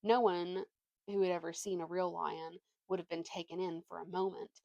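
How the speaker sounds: usual speaking voice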